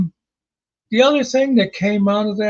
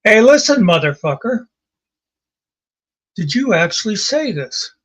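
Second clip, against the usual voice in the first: retold confrontation; 3 semitones higher than usual. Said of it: "I just very calmly said to him".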